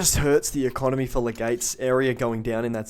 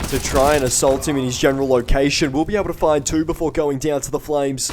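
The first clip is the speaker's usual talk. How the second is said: excited; 3 semitones higher, 7 dB louder than usual. Real, not acted. Casting a clutch fight live.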